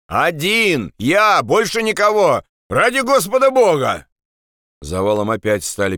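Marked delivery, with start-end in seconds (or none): 0.1-4.1 high, urgent shouted answer; pleading shout. Acted. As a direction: call back high, begging to be believed. Andres shouts back, pleading. (отчаянный крик в ответ)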